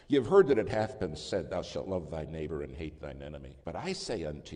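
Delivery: loud preaching of the verse; booming scripture. Real, not acted. Preacher quoting Matthew to the congregation, booming loud. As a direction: thunder the Bible quote.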